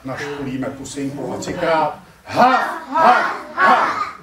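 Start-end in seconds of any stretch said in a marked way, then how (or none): 2.2-4.1 high, loud, sharp barks; dog bark. Shown as a demonstration. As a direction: demonstrate a bark like a doggie. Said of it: "třikrát jako pejsek uděláme hav". (štěkot pejska)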